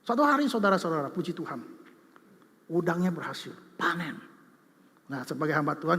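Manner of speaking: ordinary talk, nothing marked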